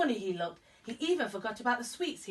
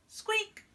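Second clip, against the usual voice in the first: a high, tiny squeak; mouse squeak; 12 semitones higher than usual. Acted. Storyteller making Mister Mouse's noise, a high squeak.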